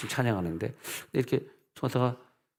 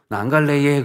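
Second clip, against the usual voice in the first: loud refusal; 4 semitones higher, 8 dB louder than usual. Retold or imitated. Refusing again.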